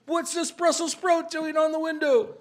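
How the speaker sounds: high, loud scolding shout; dad catching the boys, yelling from the window. Retold or imitated. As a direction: bellow it like a caught-out father. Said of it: "Then we hear my dad yell".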